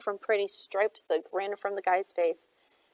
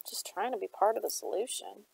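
softer, muttered under breath; muttering; 6 dB quieter than usual. A narrator's reading. Jibby retreating from Shade's scowl, muttering. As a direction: mutter it as you back off.

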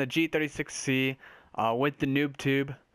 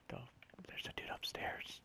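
whisper; 19 dB quieter than usual. Real, not acted. Spotting an enemy nearby.

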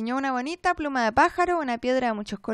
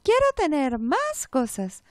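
too calm creepy doll; 3 semitones higher, 4 dB louder, slower than usual. A narrator's reading. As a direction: repeat it like a broken toy. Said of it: "contestó la muñeca". (muñeca espeluznante y demasiado tranquila)

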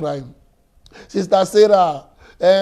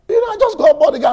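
excited; 12 semitones higher, 7 dB louder, faster than usual. Retold or imitated. Excited convert retelling joy.

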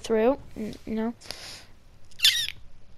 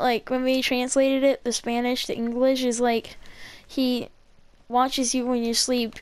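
ordinary delivery

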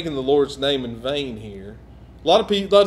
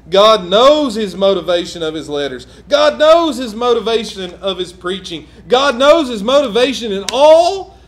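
louder building preacher repetition; preaching; 4 semitones higher, 8 dB louder than usual. Acted. Preacher to church on Paul's motives, building. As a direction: drive each "God knows" harder.

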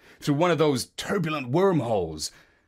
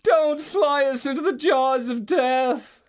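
pleading; 10 semitones higher than usual. Acted. Dreaming Marlowe begs the parrot, high pleading. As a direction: beg him not to do it, up high. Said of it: "I pleaded".

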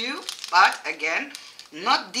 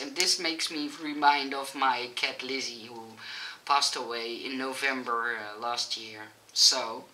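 lower, softer, trailing away; subdued sadness; 7 semitones lower, 6 dB quieter than usual. Real, not acted. Owner remembering a cat who passed away, subdued and sad. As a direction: let the voice sink with real sorrow.

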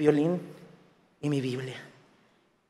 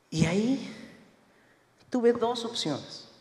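ordinary delivery

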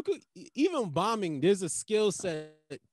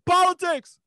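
high, loud one-word shout; imitated heckler yell; 13 semitones higher, 9 dB louder than usual. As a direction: yell it out like someone in the crowd. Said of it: "some person will yell out".

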